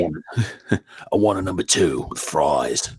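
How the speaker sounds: thick Cockney, breaking into a laugh; Cockney accent. Acted. Ordering at McDonald's, thick Cockney accent. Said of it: "Cockney British accent".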